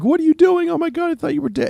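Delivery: high, startled cry of disbelief; shocked disbelief. Retold or imitated. Fujio stunned to see his dead friend, high shocked cry. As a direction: gasp it out, stunned he's alive.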